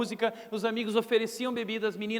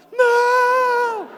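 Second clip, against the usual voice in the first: high, loud hammed-up wail of despair; desperate cry; 14 semitones higher, 10 dB louder than usual. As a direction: scream no like a doomed kid. (grito de desespero, agudo e alto, exagerado)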